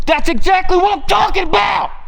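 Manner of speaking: high, shouted victory cry; triumphant shout. Real, not acted. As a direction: yell it out in triumph. Hunter celebrates a kill.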